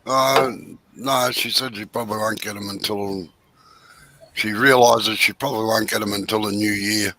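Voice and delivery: low, soft, failing voice; losing voice. Real, not acted. Friend answers about the lamps, losing voice. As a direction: talk low and quiet as the voice gives out. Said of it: "I'm losing my voice".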